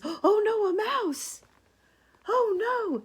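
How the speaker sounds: very high, loud mock-scared squeal; panicked elephant. Elephant spotting a mouse, hammed-up panic. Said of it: "the elephant says".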